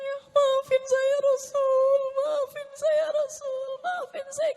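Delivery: high, long-drawn pleading wail; acted weeping. Man begging the Prophet's forgiveness, weeping high and drawn out. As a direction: beg forgiveness in a slow, high imitation sob. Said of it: "Dia nangis".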